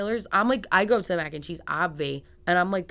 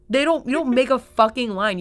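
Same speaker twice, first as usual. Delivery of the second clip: indignant rant; 6 semitones higher, 6 dB louder than usual. Real, not acted. Ranting about the hot bar line.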